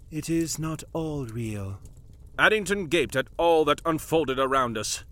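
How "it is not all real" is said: quiet denial. Acted. Madam calmly contradicting.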